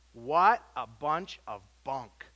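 slow, drawn-out scorn; scornful. Acted. Preacher scoffing at kids' rhyme, scornful. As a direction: dismiss it with contempt.

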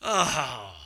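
loud, drawn-out mock sigh; exaggerated groaning "oh" of annoyance; imitated exasperated sigh. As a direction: heave a put-upon sigh, imitating him. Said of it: "he sighs".

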